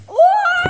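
high, loud yelp; startled cry. Acted. Struck during the fight, high startled cry.

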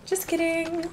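high-pitched joking retraction; playful high voice. Pretend. Player joking about the boast, playful high voice. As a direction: lift it high and play it up. Repeats it in put-on voice.

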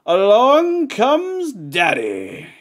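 slow, high, loud announcement; dramatic reveal. Acted. Hyping the dad's arrival to his co-host, slow and loud. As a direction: unveil the dad like a movie villain entering.

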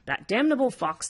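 lightly acted indignant outcry; exclaiming. A narrator's reading. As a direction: exclaim it within the story reading. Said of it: "they all exclaimed".

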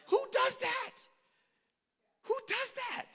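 very high-pitched, incredulous squeak; mock disbelief. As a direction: squeal it in comic bafflement. Exasperated mock disbelief at Jesus' habit.

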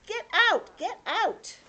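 high, loud storybook yell; angry shout. A narrator's reading. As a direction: shout the order, really angry. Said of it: "he's really angry".

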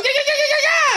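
high squealing yeah run; high excited yeahs. Real, not acted. Fan squealing over the win. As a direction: let the yeahs climb up.

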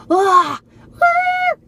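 high loud whoop; excited squeal. Real, not acted. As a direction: squeal with delight. Sees nail polish.

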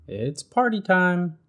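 high, slow, sing-song announcement; playful little bird voice; party-mad Bird. Acted. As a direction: stretch it out like the fun is starting. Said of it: "said Bird".

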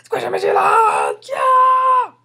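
very loud, hammed-up scream of joy; overexcited screaming. [name] freaks out over Squishy Mission Land, overexcited screaming. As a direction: lose all control with excitement. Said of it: "relax. We're all excited. Calm down".

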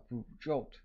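ordinary delivery

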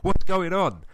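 high, loud, drawn-out challenge; imitated shout. Father imitating his son confronting another kid, shouting. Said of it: "shout at this kid saying".

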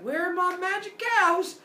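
high-pitched, put-out question; indignant demand. Acted. Apollo finds his herd missing, indignant.

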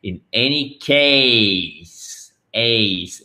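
"case" stretched into a long glide; very slow "In any case"; full diphthong. Shown as a demonstration. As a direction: draw out the "ay" in "case". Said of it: "Faites bien attention aux diphtongues".

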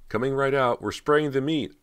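high, loud call from another room; calling from the kitchen. Acted. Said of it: "a woman's voice called from the kitchen".

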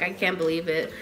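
ordinary talk, nothing marked

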